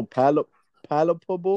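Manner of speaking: palpable sounded out slowly, syllable by syllable; slowly sounding out palpable; unsure pronunciation. Real, not acted. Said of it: "What's that word?".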